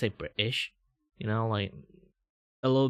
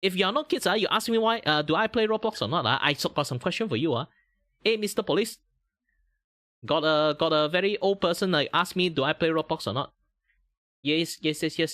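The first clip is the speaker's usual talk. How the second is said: raised, mock-serious anime-style voice; high VTuber voice; 8 semitones higher, 4 dB louder than usual. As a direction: call out to the police in a higher cartoonish voice.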